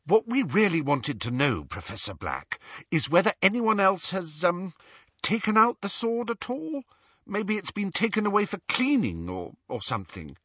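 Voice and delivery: put-on high, careful, hesitant girl; tentative Hermione. A narrator's reading. Hermione delicately questioning the portrait, high and hesitant. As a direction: feel your way politely through the question.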